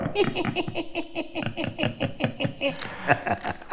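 hohes, hauchiges Hexenkichern; Hexenlachen. Pretend. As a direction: kichern wie eine alte Hexe. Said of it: "Hexenlachen. Kann ich auch". (gackerndes Hexenlachen)

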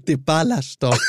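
Michael Ballack voice. Retold or imitated. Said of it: "wie sonst er Michael Ballack ausgesprochen hat".

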